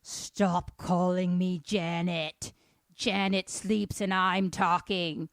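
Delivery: loud, drawn-out demon voice; possessed child's monstrous voice; demonic possession voice. Retold or imitated. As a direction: speak slowly and forcefully, like a demon through a child. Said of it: "it has this voice".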